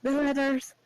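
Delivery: breathless, high and loud gasped words; out of breath. Acted. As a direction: gasp it out after running with the letter. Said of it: "Breathing heavily as you've been running".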